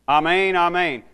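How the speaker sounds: forceful, preacherly "Amen, amen"; emphatic declaration. Retold or imitated. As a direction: hit both words of "Amen, amen" hard. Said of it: "to show emphatically this is a true statement".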